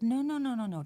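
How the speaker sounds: high, loud mock-desperate no's; pleading shout. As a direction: beg loudly, half joking. Begging realtor to tell story.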